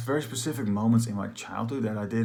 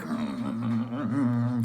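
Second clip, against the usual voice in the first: long vibrating vocal rumble; imitated launch rumble; 3 semitones higher than usual. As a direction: shudder like an astronaut at liftoff. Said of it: "in a spaceship about to launch".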